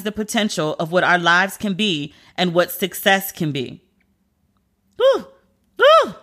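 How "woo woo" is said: sharp, high "woo"; high whoop. Real, not acted. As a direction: let out a loud, high whoop. Overcome after moving tribute.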